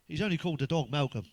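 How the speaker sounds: ordinary talk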